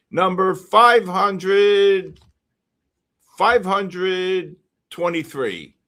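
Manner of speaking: slow, drawn-out number; slow call. Real, not acted. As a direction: spell out the number for everyone. Calling number slowly.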